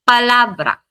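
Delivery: palabra lifted on LA, then falling; rising on the A. Shown as a demonstration. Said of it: "Pataas sa letter A".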